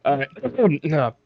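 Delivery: normal talking voice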